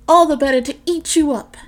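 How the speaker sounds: the wolf lunges, bellowing; the wolf pounces on the girl, yelling the last line; wolf's roar. Acted.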